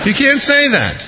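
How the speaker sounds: very high-pitched protest, falling at the end; incredulous objection. Acted. Preacher protesting Peter's answer to the Lord, high-pitched incredulity. Repeats objection, much higher.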